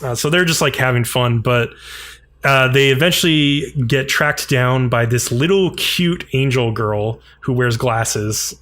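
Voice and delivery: ordinary talk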